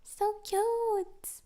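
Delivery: put-on high cutesy baby talk; gushing cutesy voice; babyish squeal. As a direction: coo at a duck. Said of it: "I'll be like".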